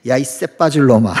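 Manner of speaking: loud imitation of granny's cursing; scolding grandmother. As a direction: scold a grandchild at volume, in jest. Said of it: "저는 어릴 때 우리 할머니에게". (욕쟁이 할머니 호통 흉내)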